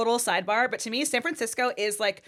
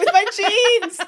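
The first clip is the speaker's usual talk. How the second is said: 边笑边唱; laughing sing-song; 13 semitones higher, 12 dB louder, slower than usual. Acted. Singing along laughing.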